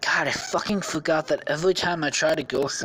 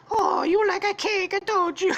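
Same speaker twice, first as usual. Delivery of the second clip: high-pitched, hammed-up Italian voice; mock Italian accent; 3 dB louder than usual. Squishy pulls out a cake, high mock Italian accent. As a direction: tease it out in squeaky fake Italian. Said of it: "Squishy's gonna be Italian too".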